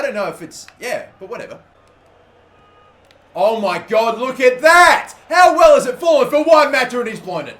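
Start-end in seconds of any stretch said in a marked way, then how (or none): 3.3-7.6 loud, much higher, amazed yelling; excited shout. Real, not acted. Gamer watching the ball fall for Mata, loud amazed shout. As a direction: shout in disbelief at the chance.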